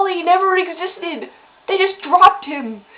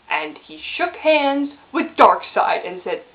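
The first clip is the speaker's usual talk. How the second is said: ordinary talk, nothing marked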